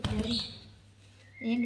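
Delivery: usual speaking voice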